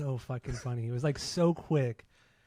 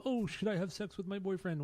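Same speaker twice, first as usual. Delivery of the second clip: mock caller; 7 semitones higher than usual. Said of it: "people call in and talk about".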